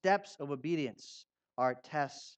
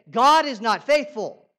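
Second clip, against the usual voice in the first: loud, hard "God is not faithful."; blunt declaration; 5 semitones higher, 12 dB louder than usual. Shown as a demonstration. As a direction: say it bluntly to shock. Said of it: "I want you to say".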